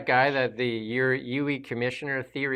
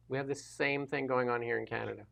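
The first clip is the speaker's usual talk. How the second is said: softer echo of the line; quieter repeat; 8 dB quieter than usual. Real, not acted. Guest quietly repeats his point about Canada, much softer. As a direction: repeat it under your breath.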